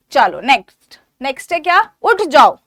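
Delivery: ordinary delivery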